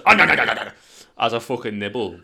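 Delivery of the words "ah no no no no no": loud, hammed-up cry of pain; loud pretend pained protest; mock yelp. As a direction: yelp as the hand gets bitten. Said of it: "he fucking goes".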